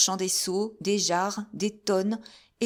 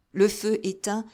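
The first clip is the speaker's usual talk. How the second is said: ordinary delivery, nothing marked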